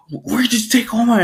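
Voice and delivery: high, fast, urgent question; alarmed accusation. Acted. Boy accusing stranger of taking All Might, alarmed. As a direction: demand it in mock panic.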